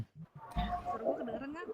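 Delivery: faint, distant and muffled; muffled far-off voice. Real, not acted. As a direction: sounds like talking from underwater. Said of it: "Apa suaranya kecil amat".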